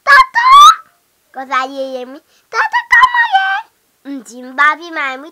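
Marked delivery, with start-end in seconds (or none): 0.0-0.5 very high, loud squeal on "Tata"; squealed baby call. Pretend. Child as ti bebe calling tata, high squeal. Baby voice set against usual voice. 2.5-3.5 very high, loud squeal; squealed baby call. Pretend. Child as ti bebe calling tata, high squeal.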